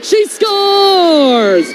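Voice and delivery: high, drawn-out shout falling at the end; long goal yell. Real, not acted. Goal scored in play-by-play.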